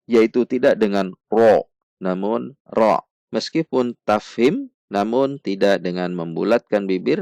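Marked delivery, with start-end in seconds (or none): 2.0-3.0 ra with unrounded lips and a raised tongue root; Arabic ra. Shown as a demonstration. Teacher corrects ro to ra: unrounded ra. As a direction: say ra without rounding the lips. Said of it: "bukan dengan membulatkan bibir".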